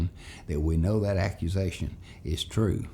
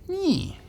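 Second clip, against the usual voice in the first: taunting nyeh. Retold or imitated. Classmate taunts him.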